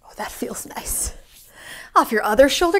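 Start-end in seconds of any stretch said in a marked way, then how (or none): 0.0-1.1 slow, soft whispered relief; whispered pleasure; relieved whisper. Pretend. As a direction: breathe it out like relief. Enjoying shaking off sand.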